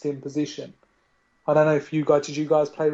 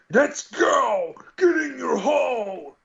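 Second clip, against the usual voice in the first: high, loud, drawn-out battle cry; screaming action hero; 10 semitones higher, 3 dB louder, slower than usual. Acted. Mocking muscle-bound game heroes to fellow gamers, hammed-up scream. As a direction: bellow it like a chainsaw-wielding muscle man. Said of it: "gun screaming".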